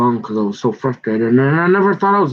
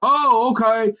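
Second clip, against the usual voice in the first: mock surprise; 11 semitones higher, slower than usual. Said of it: "and you go".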